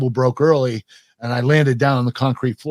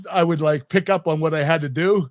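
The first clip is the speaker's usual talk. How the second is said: ordinary delivery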